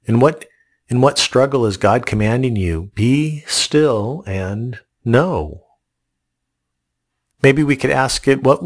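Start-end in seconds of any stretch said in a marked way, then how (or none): 3.0-5.7 slow solemn quote. Acted. Quoting God's command slowly.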